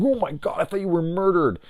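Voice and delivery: high-pitched retold panic; freaking out. Him to friend feared murdered, high retold freak-out. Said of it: "Like, I was freaking out".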